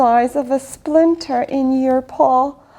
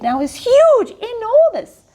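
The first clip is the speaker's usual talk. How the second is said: growing excitement; 9 semitones higher than usual. A narrator's reading. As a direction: stretch it bigger each word. Repeats the growing splinter refrain.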